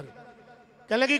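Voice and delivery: ordinary delivery, nothing marked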